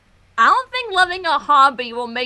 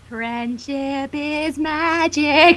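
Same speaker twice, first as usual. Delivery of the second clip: slow quoted motto; much slower than usual. Acted. Quoting the show's motto.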